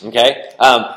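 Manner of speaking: usual speaking voice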